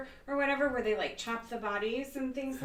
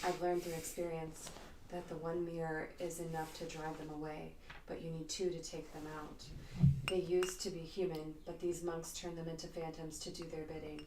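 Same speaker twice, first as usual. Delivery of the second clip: sultry voice; 3 semitones lower, 8 dB quieter than usual. Acted. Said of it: "a little bit more of a sultry voice".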